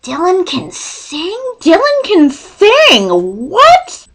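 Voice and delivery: slow, rising disbelief ending in a high what; mock amazement, building up; retold surprise. Remembering finding out Dylan can sing, amazed. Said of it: "I was just like".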